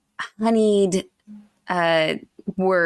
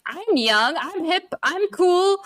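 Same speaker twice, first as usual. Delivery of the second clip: mock young and hip; 10 semitones higher, slower than usual. Old king acting young.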